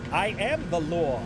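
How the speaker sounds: slow, mock-stern Mid-Atlantic accent lawman; a cop's decree in a lightly done Mid-Atlantic accent; Judge Dredd, Mid-Atlantic. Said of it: "Judge Dredd if he had a Mid-Atlantic accent".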